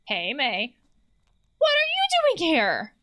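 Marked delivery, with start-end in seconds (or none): 1.6-2.9 much higher, slow, drawn-out character voice; squeaky Germ voice. Acted. Germ surprised to find May, high squeaky voice.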